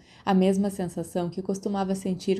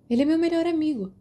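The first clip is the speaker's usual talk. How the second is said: promptly answering; 9 semitones higher, 3 dB louder than usual. A narrator's reading. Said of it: "Lily respondeu prontamente".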